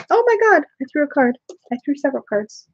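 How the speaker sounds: sudden high startled cry. Real, not acted. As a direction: caught off guard by flying cards. Dropping cards while shuffling, surprised.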